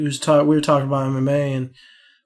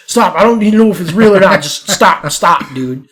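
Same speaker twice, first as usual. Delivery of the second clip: loud, higher pleas, easing at the end; mock panicked pleading; 3 semitones higher, 8 dB louder than usual. Bar back as Buddha steps closer, mock panic. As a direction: act out someone desperate to halt it. Retold kid backing away from raised hand.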